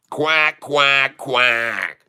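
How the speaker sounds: short spoken quacks like a duck. Pretend. Trader quacking at his chart like a mallard duck.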